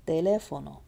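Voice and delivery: "Teléfono" with a strong second syllable; stress on LE. Shown as a demonstration. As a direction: punch "lé" in "Teléfono", then fall away. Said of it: "It's on the le".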